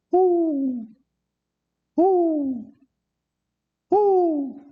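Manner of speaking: long, high sustained hoots; imitated hoot owl. Treed escapee fooling the sheriff, high fake owl hoots. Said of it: "Ain't nothing but an old hoot owl".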